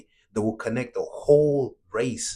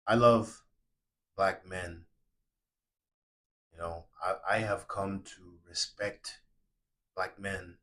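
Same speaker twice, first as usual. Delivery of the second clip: sincere from the soul; 4 semitones lower than usual. Real, not acted. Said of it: "because I'm talking from my soul".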